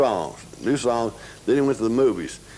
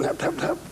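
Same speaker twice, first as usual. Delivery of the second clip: imitated knocking; 4 dB quieter than usual.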